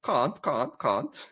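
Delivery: playful South African "can't" mimicry; teasing South African can't. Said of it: "my wife is like".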